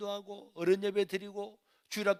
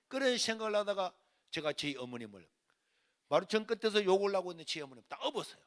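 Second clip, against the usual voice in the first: ordinary delivery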